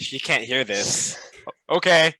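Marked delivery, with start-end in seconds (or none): 1.7-2.1 a loud, higher reply. Acted. Replying aloud to a secret magic message, loud. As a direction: answer the whisper at full voice. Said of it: "I reply out loud".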